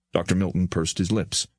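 ordinary delivery, nothing marked

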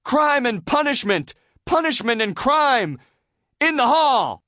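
very high, loud slogans; grandiose oratory. A narrator's reading. SpongeBob rambling on to students, proclaiming. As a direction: proclaim each phrase as a ringing slogan.

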